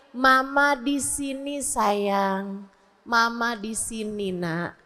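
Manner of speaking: soft, slow, drawn-out reassurance; soothing mother. Shown as a demonstration. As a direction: comfort a crying child gently and quietly. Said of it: "kasih ketenangan".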